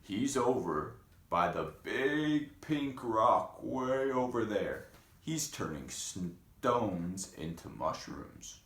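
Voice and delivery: frogs pointing the way, slowly; frogs' voice. Acted. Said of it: "they said".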